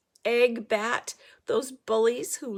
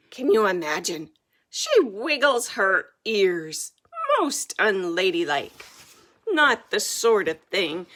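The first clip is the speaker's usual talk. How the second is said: thundering king; 9 dB louder than usual. A narrator's reading. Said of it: "thunders the king".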